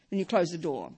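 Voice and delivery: ordinary talk, nothing marked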